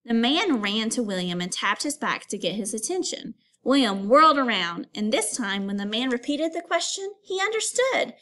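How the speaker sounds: lively read-aloud storytelling; story reading. Acted. Teacher reading a picture book aloud to students. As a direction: read the story to the class with expression.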